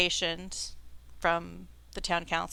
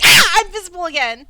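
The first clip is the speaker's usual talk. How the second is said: startled imp; 9 semitones higher, 14 dB louder than usual. Acted. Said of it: "He's like".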